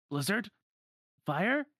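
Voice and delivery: high, questioning cries; doubtful spell-casting. Acted. Donald casts while nothing happens, high and uncertain.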